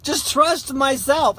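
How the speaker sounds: high, loud self-reproach; frustrated outcry. Real, not acted. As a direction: wail it loudly in regret.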